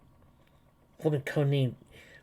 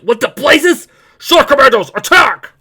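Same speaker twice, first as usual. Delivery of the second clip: high, very loud yell; battle shout; 13 semitones higher, 15 dB louder than usual. Acted. Commandos burst in and are ordered to attack, battle shout. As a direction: shout it urgently in full alarm.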